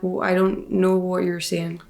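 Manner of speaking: ordinary delivery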